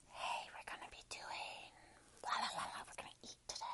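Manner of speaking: breathy mock ASMR whisper right into the mic. Vlogger mocking ASMR creators, whispered. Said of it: "into the fucking mic".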